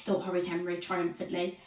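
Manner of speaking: ordinary delivery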